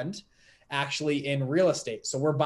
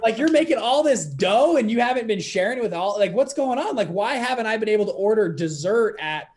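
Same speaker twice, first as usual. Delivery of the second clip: much higher and louder, half-laughing indignation; mock outrage; 6 semitones higher, 6 dB louder than usual. Son bursting in on his dad about hidden money, mock outraged. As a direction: play the betrayed son demanding answers. Confronting dad after the revelation.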